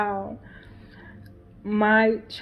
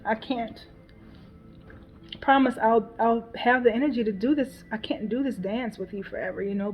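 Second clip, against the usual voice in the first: ordinary talk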